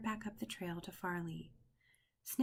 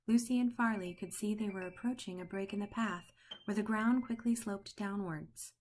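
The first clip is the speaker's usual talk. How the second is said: usual speaking voice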